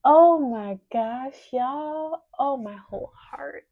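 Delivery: loud, high, drawn-out exclamations; real joy spilling over, stretched and high; overwhelmed amazement. Real, not acted. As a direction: wail it out in happy shock. Overwhelmed as visitor count climbs.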